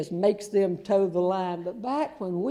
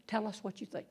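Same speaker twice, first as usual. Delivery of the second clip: quiet, gentle "Tell us what you think." said softly; soft coaxing; 10 dB quieter than usual. Retold or imitated. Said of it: "You go up and you say".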